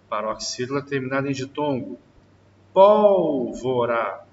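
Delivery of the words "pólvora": slow, loud drawn-out word. Shown as a demonstration. Teacher slowly and loudly stretches the example word for the class. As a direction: stretch it out and project it. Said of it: "teacher drawing out example word".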